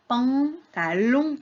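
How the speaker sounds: "pantalon" slow, syllable by syllable; sounding out. Shown as a demonstration. Teacher names the last picture for children, sounding it out. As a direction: hold "pan" long in "pantalon".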